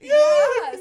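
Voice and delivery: loud, high laughing yeah; real laughing. Real, not acted. Host agreeing with guest's joke, laughing hard. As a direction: agree through a big burst of laughter.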